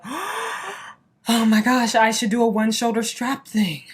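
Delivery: very slow, stretched-out thrilled "Oh my gosh"; as if struck by a brilliant idea; excited realization. Retold or imitated. Reliving her reaction to Malika's idea, hammed-up excitement. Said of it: "I was just like".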